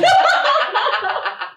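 bursts out in a high laugh; loud high laugh. Real, not acted. Telling a friend about her blunder, laughing loudly. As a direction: laughs hard at the memory.

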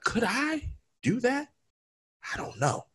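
small doubtful voice. Retold or imitated. Retold self-doubt watching the challenge.

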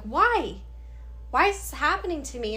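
throwing the question at the ceiling; desperate why. Retold or imitated. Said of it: "I just lost it".

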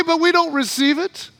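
normal talking voice

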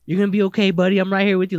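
hammed-up hero comforting a crash victim; mocking the cameraman shouting comfort; mock reassurance. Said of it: "And he's like".

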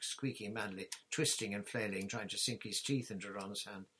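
ordinary delivery

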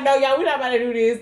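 rushed loud refusal through laughter; fast, loud, exasperated. Retold or imitated. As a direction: blurt it out, half laughing. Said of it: "I was like".

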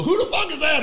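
very high-pitched mock alarm; high, exclaimed retold question; alarmed imitation. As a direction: imitate startled soldiers demanding to know who's shooting. Imitates alarmed special forces guys.

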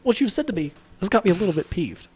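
high put-on voice, mildly cross; mock restrained indignation; Jordan Peterson. Imagining Jordan Peterson getting angry, high and peeved. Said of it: "I can't imagine he gets angry".

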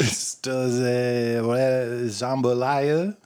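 put-on very slow, laboured French accent. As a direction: stretch every word in a mock French accent. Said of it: "jambalaya in a French accent".

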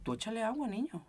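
a hint of a confident grandma. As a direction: say it with total certainty. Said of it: "con una seguridad de".

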